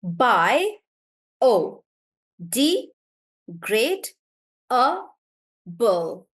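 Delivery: "Bi", "o", "d", "great", "a", "bull" each said as a separate beat with pauses; broken into syllables. Shown as a demonstration. Teacher splitting biodegradable into syllables for the class. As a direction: clap out every syllable of "Bi o d great a bull" on its own.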